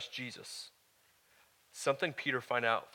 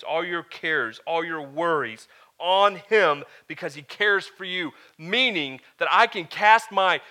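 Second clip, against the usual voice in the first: loud, high, impassioned sermon delivery; preaching at full force; 5 semitones higher, 10 dB louder than usual. Acted. Pastor to his congregation about God's care, preaching at full force. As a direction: preach it out to the whole room, full voice.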